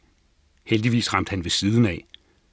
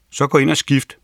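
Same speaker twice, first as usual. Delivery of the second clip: raised pitch, firm order; an order to a child; commanding; 5 semitones higher, 4 dB louder than usual. A narrator's reading. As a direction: give a brisk grandfatherly order. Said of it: "befalede morfar".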